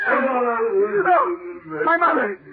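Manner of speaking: high crying cry fading to a broken whisper; a son wailing as the thing takes his mother; anguished wail. Acted. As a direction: let the horror collapse into grief.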